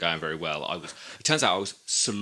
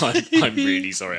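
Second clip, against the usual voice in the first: much higher, much louder apology; 8 dB louder than usual. Retold or imitated. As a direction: blurt the sorry out as if still shouting. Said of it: "You were shouting quite a lot".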